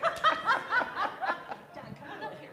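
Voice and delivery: laughing, high, loud call to John. Real, not acted. Host calls John up to the front, laughing. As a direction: wave him up while laughing.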